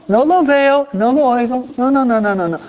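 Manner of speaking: high-pitched mock refusal to see or hear; insistent sing-out denial, imitated; mock willful denial. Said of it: "yo me hice el ciego".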